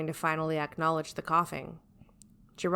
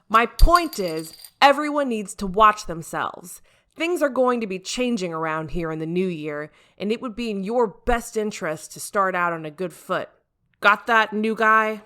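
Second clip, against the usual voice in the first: stern boss lecture; 4 semitones higher, 8 dB louder than usual. Acted. Captain warning the new guy, loud stern lecture. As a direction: lay down the law to the team.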